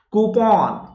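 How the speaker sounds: "कूपन" as koo-PAWN, falling; teacher's "कूपन" with open -pawn; model coupon. Shown as a demonstration. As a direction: stretch "कूपन" to end in a long aw. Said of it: "आज के बाद से क्या बोलना है".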